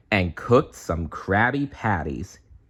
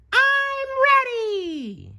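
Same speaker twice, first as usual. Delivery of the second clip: very high, slow stretched shout; SpongeBob shouting; much slower than usual. A narrator's reading. SpongeBob fired up for job hunting, very high slow shout. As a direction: belt it out eagerly. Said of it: "he shouted".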